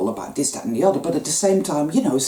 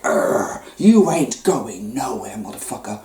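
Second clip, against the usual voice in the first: predator growl; 7 semitones lower than usual. Acted. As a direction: snarl it like a beast holding its catch.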